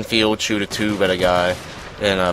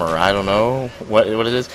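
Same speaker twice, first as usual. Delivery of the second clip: normal talking voice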